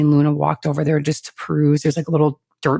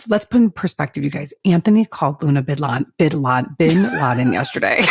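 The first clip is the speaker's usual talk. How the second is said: ordinary talk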